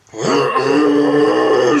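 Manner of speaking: long loud straining groan; drawn-out noise of pulling with all your might; loud, sustained grunt of effort; strained effort noise. Retold or imitated. Acting out pulling her head.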